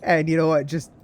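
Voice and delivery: loud, high burst of excitement; gleeful exclamation; excited. Real, not acted. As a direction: blurt it out thrilled. Excited outburst.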